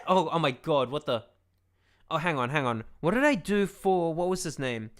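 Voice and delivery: ordinary talk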